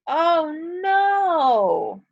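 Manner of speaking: high, strong exclamation; scared cry. Shown as a demonstration. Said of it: "Notice how my voice went up".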